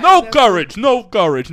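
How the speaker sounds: loud, punched-out comedian impression; rough attempt at a comic's catchphrase delivery; Rodney Dangerfield. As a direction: imitate a stand-up's loud complaint. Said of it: "you kinda sound like Rodney Dangerfield".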